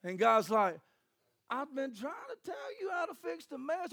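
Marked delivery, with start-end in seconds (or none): 1.5-3.9 high, straining plea; exasperated God. Acted. God answering people in a mess, high exasperation. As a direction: push it up high without extra volume.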